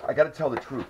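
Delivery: ordinary talk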